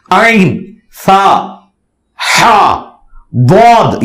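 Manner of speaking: "عین، سا، ہا، بود" each drawn out from its proper articulation point; letters articulated carefully. Shown as a demonstration. Speaker showing how Arabic letters should come out, careful isolated sounds. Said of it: "یہ صحیح نکلنا چاہیے".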